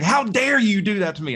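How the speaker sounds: imitating an angry boss. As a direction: act out the boss's indignant telling-off. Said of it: "he was so mad".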